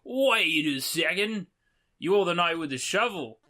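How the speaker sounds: hammed-up Aussie recognizing someone; terrible Australian accent. Traveller recognizing the knight with the shovel, bad Australian accent. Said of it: "sorry for the terrible Australian accent".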